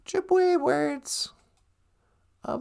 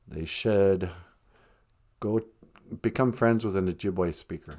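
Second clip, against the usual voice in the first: very low voice; 7 dB quieter than usual. Acted. Low answer voice.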